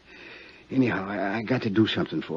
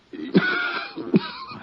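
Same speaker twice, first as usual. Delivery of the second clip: harsh, wracking sick cough; a dying man's chest cough; hacking cough; 3 dB louder than usual. Acted. Dying inmate coughing hard while talking to Johnny.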